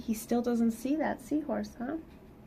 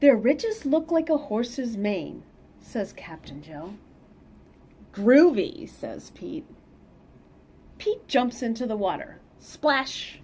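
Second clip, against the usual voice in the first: lively read-aloud storytelling; picture book reading voice; story narration; 4 semitones higher, 5 dB louder, slower than usual. Acted. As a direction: read it to the kids with bounce.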